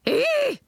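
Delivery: very high shrill ape screech; piercing high monkey squeal; chimp screech. A narrator's reading. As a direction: shriek like a startled chimp. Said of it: "he screeched".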